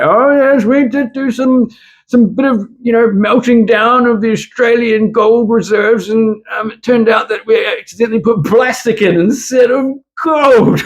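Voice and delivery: very high, mock-British falsetto; British bank official. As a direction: squeak a hammed-up British excuse. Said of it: "the British bank will be like".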